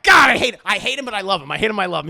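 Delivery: frustrated outburst. Real, not acted. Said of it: "I'm like".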